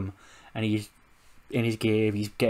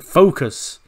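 stern; 5 semitones higher, 9 dB louder than usual. Acted. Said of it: "And then Edge is like".

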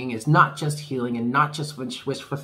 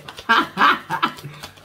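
high laughing burst; 10 semitones higher, 5 dB louder than usual. Real, not acted. Laughs at the card drawn.

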